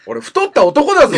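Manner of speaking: loud, high comic outrage; outraged protest. Real, not acted. Host objects to being treated as the woman, outraged shout. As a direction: burst out in indignant self-mockery. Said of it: "一旦落ち着こう".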